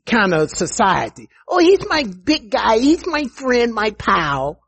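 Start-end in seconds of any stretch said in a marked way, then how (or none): 1.5-4.6 high, breezy mockery of a careless believer; mocking casual believer. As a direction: sneer at their chummy talk. Mocking cavalier believers again.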